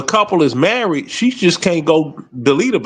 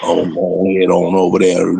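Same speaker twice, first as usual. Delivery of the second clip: Papa Bear; 12 semitones lower than usual. Retold or imitated. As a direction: rumble it like a cartoon hillbilly bear. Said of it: "They sound like Papa Bear from the Hillbilly Bears".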